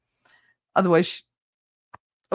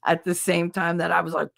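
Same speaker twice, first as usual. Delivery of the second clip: ordinary delivery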